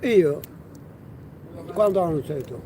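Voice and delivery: usual speaking voice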